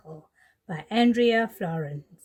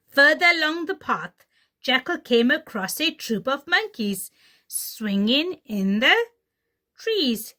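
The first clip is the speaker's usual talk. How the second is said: put-on lively read-aloud for kids; storybook reading; 5 semitones higher, 5 dB louder than usual. Teacher reading The Lion and the Clever Little Jackal to children, animated storytelling. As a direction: tell it like a bedtime tale.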